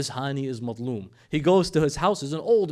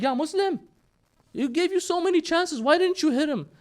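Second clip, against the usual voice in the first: much higher-pitched, exasperated outburst; exasperated confrontation; 5 dB louder than usual. Acted. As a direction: demand an explanation at a high pitch.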